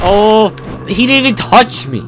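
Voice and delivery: loud, high astonished yell; shocked shout. Real, not acted. Gamer yelling at a hit that never landed, loud and high. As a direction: cry out in disbelief.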